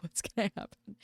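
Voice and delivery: hushed, worried question; anxious whisper. Retold or imitated.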